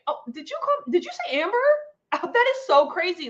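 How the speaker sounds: lightly surprised, innocent question about the name. Shown as a demonstration. Correcting someone who got her name wrong, asks innocently. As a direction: play dumb and ask if they said Amber. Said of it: "I always just say".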